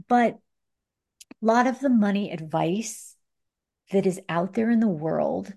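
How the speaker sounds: usual speaking voice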